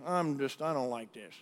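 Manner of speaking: quietly mocking the unengaged worshipper; mock indifferent mumble. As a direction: toss it off like a bored churchgoer. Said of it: "and say".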